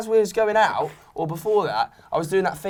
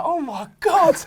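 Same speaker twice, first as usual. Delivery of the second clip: high squeal of disbelief; shocked; 11 semitones higher, slower than usual. Retold or imitated. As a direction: gasp in mock horror. Reaction after hit, laughing.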